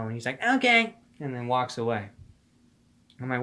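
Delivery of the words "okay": high-pitched mock okay; imitated street stranger. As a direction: mimic the stranger giving up in a high voice. Mimics stranger giving up.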